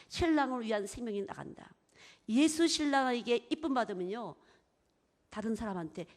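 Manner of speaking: ordinary talk, nothing marked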